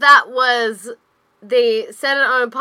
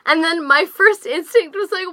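normal talking voice

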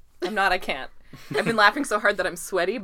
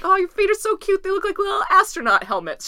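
high, gushing coo over cute feet; squealy adoring gush; 12 semitones higher, 4 dB louder than usual. Retold or imitated. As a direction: coo loudly as if at a baby. Retelling own cooing over tiny feet.

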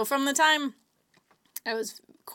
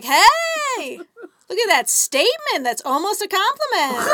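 sarcastic retort; 10 semitones higher, 8 dB louder, slower than usual. Retold or imitated. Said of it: "And I was like".

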